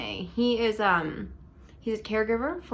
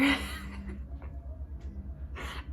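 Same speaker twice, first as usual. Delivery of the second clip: soft breathy laugh; 6 semitones higher, 9 dB quieter than usual. Real, not acted. Laughing at lazy eye.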